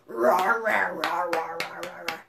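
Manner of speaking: playful low dinosaur roars; dinosaur growl. Shown as a demonstration. Teacher playing dinosaur for children, low playful roar. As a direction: growl like a friendly dinosaur for kids. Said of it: "That's my dinosaur growl".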